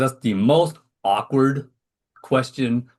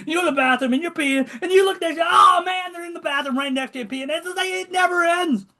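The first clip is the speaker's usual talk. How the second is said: much higher, shouted comic disbelief; hammed-up exasperation. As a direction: peak the bit in mock outrage. Comic escalating story climax.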